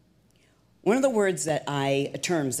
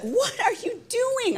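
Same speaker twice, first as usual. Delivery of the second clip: very high, drawn-out mock yell; screechy-high mimicked scolding, stretched out; imitating furious director; slower than usual. Director storming in at the trainee, mock fury. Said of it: "she came storming into the room".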